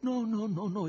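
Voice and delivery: high, quick protesting no's; exclaimed. Acted. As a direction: burst out in denial. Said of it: "esclamò Ivan".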